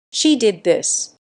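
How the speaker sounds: slow, loud shout. A narrator's reading. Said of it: "he shouted".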